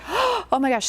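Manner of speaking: high, overwhelmed cry of shock; mock the client's sudden distress; emotional outburst. Client in regression suddenly discovering a loss, overwhelmed. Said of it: "so emotional".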